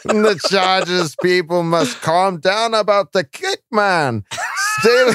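put-on slow comic Kazakh accent, cracking up; mock Kazakh accent. Host losing it over a listener's joke, mock Kazakh accent. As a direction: keep the fake Kazakh voice while breaking into laughter.

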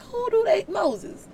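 God fed up with his people; exasperated God. Retold or imitated. God venting to Moses about the Israelites, indignant and rising. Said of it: "literally his conversations with Moses".

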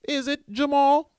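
high, loud teasing call; mock call-out to Jamal. Host turns to a made-up listener with a loud high call. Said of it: "I don't know why I said Jamal".

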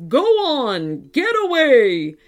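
loud monster command; roaring robot dinosaur. A narrator's reading. Robot dinosaur drives children from the volcano, roaring. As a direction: roar to drive them off. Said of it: "roared the robot dinosaur".

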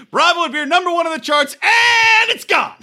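high-pitched, stretched-out exclamation; mock announcement. Host joking about getting canceled, mock-dramatic shout. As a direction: call it out high, dragging the ending.

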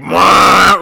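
loud comic "mwah" noise; big, drawn-out comic blurt; mimed big eyebrows. Retold or imitated. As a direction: burst out a big cartoon noise.

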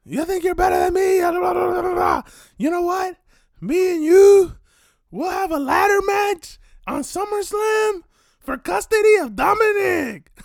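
high, loud mock yell; yelling challenge. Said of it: "he starts yelling".

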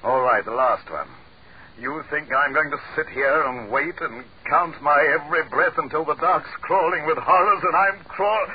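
loud, high-pitched desperate tirade; frantic outburst. Acted. Breaking down, refuses to wait.

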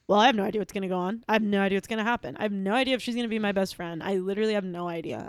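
fast, rushed inner monologue; racing thoughts. Retold or imitated. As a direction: rattle it off as if panicking inside. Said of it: "I thought".

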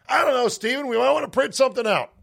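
high, fast, breezy dad impression; high and quick, a cheerful dad voice. Dad's reply voiced in story.

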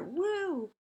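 high mock cheer; high cheerleader woo; cheerleader whoop. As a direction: whoop like a pep rally cheerleader.

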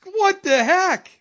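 high, squealed incredulity through laughter; laughing in disbelief. Real, not acted. Co-host sorry for friend's pain, high laughing disbelief. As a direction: apologize while cracking up, pitch way up. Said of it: "I don't mean to laugh".

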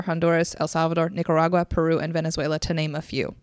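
ordinary talk, nothing marked

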